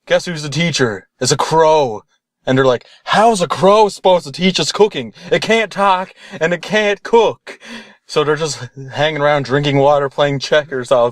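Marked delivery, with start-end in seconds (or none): 3.0-7.6 high-pitched mock complaint, slowing at the end; incredulous protest. Students questioning a crow as cooking teacher, incredulous. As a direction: object as if it's utterly absurd.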